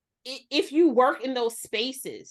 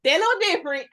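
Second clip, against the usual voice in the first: louder, higher, little stressed; emphatic repeat; 7 semitones higher, 5 dB louder, faster than usual. Real, not acted. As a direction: punch the word little. Repeated remark on Aquarius men.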